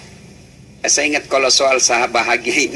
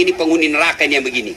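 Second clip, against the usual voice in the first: ordinary delivery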